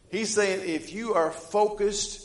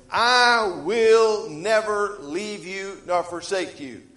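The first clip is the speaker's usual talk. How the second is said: slow and louder, each word weighted; a steady, booming divine promise; slow, solemn voice of God; 5 semitones higher, 6 dB louder, slower than usual. Retold or imitated. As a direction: lay down God's promise slowly, with force. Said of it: "God says".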